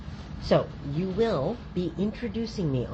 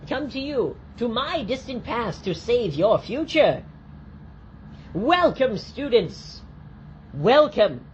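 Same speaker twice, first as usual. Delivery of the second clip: grand orator; 6 semitones higher than usual. Pretend. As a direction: address the children like a visionary. Grand time traveller speech.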